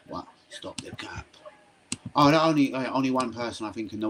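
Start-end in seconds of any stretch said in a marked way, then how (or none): 0.1-1.2 soft whisper, barely voiced; whispered aside. Real, not acted. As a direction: breathe it out under your breath. Muttered aside to chat.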